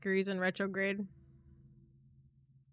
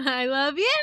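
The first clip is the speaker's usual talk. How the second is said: laughing; 4 semitones higher, 5 dB louder than usual. Real, not acted. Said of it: "said twice, differently".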